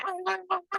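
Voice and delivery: high, soft little squeals; small high excited yelps; breathless squeals. Retold or imitated. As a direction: gasp out tiny squeals of joy. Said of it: "I was like".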